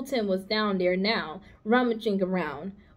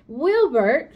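louder call across the barn to Wilbur; 4 semitones higher, 6 dB louder than usual. Acted. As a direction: call the pig awake. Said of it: "she called".

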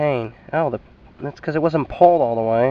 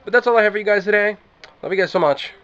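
higher, faster, brighter outro; pep it up for the goodbye; upbeat sign-off; 8 semitones higher, 6 dB louder, faster than usual. Real, not acted. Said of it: "Not, not fun".

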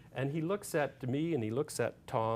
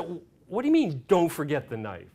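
louder, puzzled question thrown back; mock-bewildered, raised voice; incredulous retort; 5 semitones higher, 9 dB louder than usual. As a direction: question the order in disbelief. Acted puzzled retort to the order.

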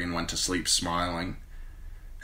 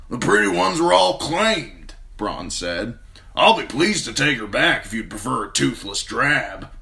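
higher, louder rough sellsword; 5 semitones higher, 6 dB louder than usual. A narrator's reading. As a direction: play the cocky sellsword. Narrator voices Bronn.

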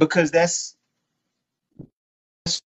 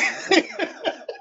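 laugh; 6 dB quieter than usual. Real, not acted. Laughing at friend's trouble.

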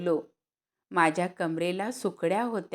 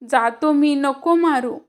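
loud, higher begging; pleading cry; 8 semitones higher, 8 dB louder than usual. Acted. Boy begging his mother to stop beating him, loud pleading cry.

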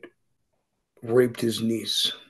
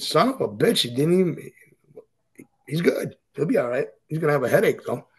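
mock amused; 4 semitones higher than usual. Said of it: "they were all laughing, and they said".

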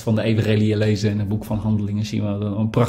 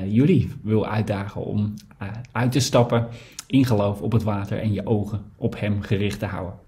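ordinary talk, nothing marked

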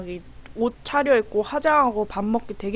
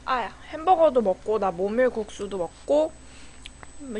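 words between spoonfuls; talking while chewing. Real, not acted. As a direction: mouth partly full of food. Seen 0: Spoon at lips, then speaks between bites of soup.

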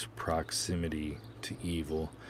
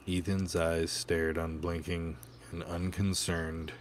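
ordinary delivery

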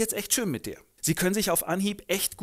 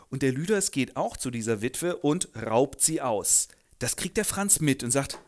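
ordinary delivery

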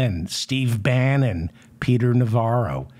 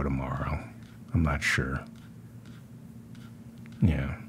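softly whispered aside; 10 semitones lower, 10 dB quieter than usual. Real, not acted. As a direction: whisper it to yourself. Quiet aside near the end.

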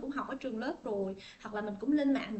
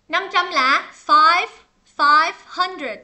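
calling out answer; 3 semitones higher, 14 dB louder, much slower than usual. Real, not acted. Teacher calling answer to class.

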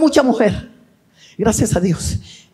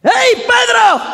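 high, drawn-out call to a disciple; 13 semitones higher, much slower than usual. Acted. Jesus calls to Pedro on arriving at the temple, high drawn-out call. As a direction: hail him from afar, pitched right up.

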